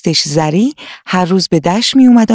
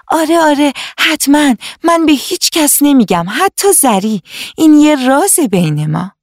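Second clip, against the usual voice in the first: much higher, keen promising voice; eager little girl; 8 semitones higher than usual. Acted. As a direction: promise eagerly, like a thrilled child. Hana promises to keep secret.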